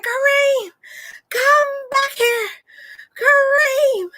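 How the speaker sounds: high, loud call to the grandson. Retold or imitated. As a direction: call him back up the hill. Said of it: "I said".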